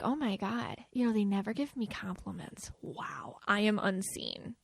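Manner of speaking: half-joking self-pity, fading to a whisper; mock hurt. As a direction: pout it out, sinking to a whisper. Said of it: "that empath or a partner can be like".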